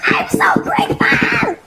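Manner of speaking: very high squealed thanks, near tears; overwhelmed gratitude. Real, not acted. Vlogger grateful for her long-wanted pot, squealing near tears. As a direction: squeal it with real joy. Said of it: "I feel like I'm gonna cry".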